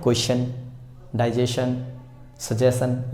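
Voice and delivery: क्वेश्चन, डाइजेशन, सजेशन said softly with plain shun endings; the casual way, shun not stchun; everyday pronunciation. Shown as a demonstration. Teacher showing how people usually say these words. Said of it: "लेकिन हम general life में क्या बोलते हैं".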